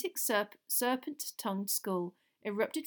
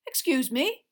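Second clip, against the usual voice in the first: louder, raised-pitch disbelief; incredulous; 6 semitones higher, 8 dB louder than usual. A narrator's reading. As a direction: question it as if you misheard. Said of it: "said Mister Diggory incredulously".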